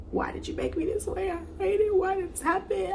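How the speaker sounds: high, strained, crying plea to God; anguished plea. Retold or imitated. Weeping alone in her apartment, crying out to God in anguish. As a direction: break the voice up high as you beg.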